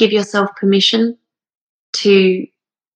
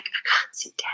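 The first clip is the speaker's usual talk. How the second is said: unvoiced, softer inner plea; whispered protest; 13 dB quieter than usual. She whispers her own tearful reply to granny. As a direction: breathe it out like a secret.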